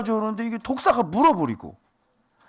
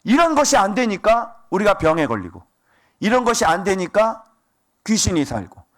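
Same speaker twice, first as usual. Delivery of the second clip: ordinary talk, nothing marked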